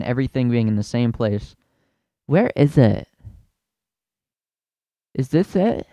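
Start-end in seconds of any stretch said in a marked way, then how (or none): 2.3-5.9 lightly voiced high confused mom; a hint of a lost shopper; mocking a lost shopper; clueless mom. Imitating clueless moms.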